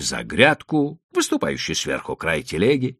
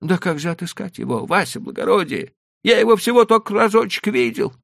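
much higher, thin, meek trader's voice; high, pleading old merchant; 6 dB louder than usual. A narrator's reading. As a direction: play the cautious Leiba, pitched way up. Leiba frets.

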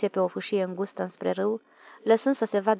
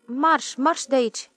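shooing shout; 5 semitones higher, 5 dB louder, slower than usual. Acted. Shooing the animal away.

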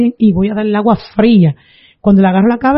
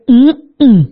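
imitated "mm-mm" of refusal, pitched up; closed-mouth refusal grunt; 3 semitones higher than usual. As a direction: grunt a no with lips shut. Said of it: "y ella decía". (gemido de negativa imitado)

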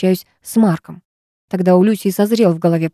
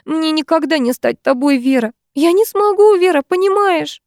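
high, sobbing outcry; sobbing aloud; 8 semitones higher than usual. A narrator's reading. Lyusya sobs at Vera while struggling at the edge, wailing high. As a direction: wail it through tears. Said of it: "рыдая в голос".

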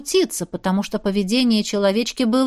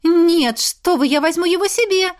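pleading refusal, pitched up; eager protest; 8 semitones higher than usual. A narrator's reading. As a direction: object eagerly in a girlish high voice. Girl protests to keep the squirrel.

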